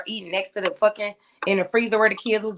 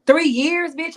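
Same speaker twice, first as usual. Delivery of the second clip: higher and louder, disbelieving; shocked question; 8 semitones higher, 4 dB louder, slower than usual. Retold or imitated. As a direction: call it out in disbelief. Shocked at cellmate's sentence.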